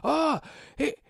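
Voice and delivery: very high, loud screaming accusation; hysterical friend. Acted. Friend screaming murderer at the Minotaur, high and frantic. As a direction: shriek murderer at the crowd. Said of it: "he's going".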